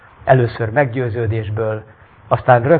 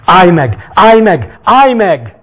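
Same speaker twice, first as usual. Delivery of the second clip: higher, loud repeated cry; desperate shouting; 7 semitones higher, 10 dB louder, much slower than usual. Acted. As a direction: shout after him, building. Crowd calling to Jesus.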